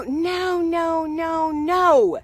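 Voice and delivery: brushing off the outrage with a long no; firm no. Retold or imitated. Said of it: "playful refusal".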